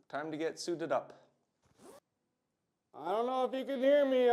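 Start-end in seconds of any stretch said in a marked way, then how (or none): 3.0-4.3 loud talk behind a respirator mask; muffled through respirator. Real, not acted. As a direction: speak up through a face mask. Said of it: "in my respirator".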